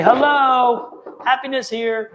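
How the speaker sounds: high, loud chirpy visitor at the door; cheery doorstep greeting. Pretend. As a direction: sing it out like a cheery caller at the door. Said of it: "knock on your door and say".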